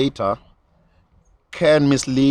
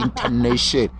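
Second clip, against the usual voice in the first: "intonation" lower, in a Chivenda accent; 4 semitones lower, slower than usual. Shown as a demonstration. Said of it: "giving it a Chivenda accent".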